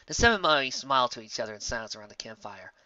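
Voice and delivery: plain reading voice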